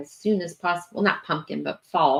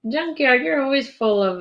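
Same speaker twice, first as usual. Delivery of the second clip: high, louder, sing-song mock scolding; a high playful call to a joker in the chat; playful teasing call; 8 semitones higher, 4 dB louder than usual. Real, not acted. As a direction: tease the viewer by name, playfully. Teasing a joking viewer.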